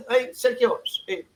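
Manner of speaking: lightly voiced player begging politely for the ball; mock timid player. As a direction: mimic a meek player pleading for a pass. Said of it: "tells Peña".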